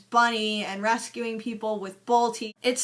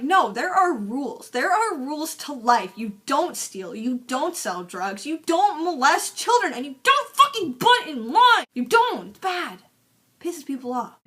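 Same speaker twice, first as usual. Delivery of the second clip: mock righteous rant; 4 semitones higher, 3 dB louder than usual. Frank scolds the villain, a building mock rant. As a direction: lecture building to a higher, louder outburst on the last rules. Frank lectures Jacques on rules.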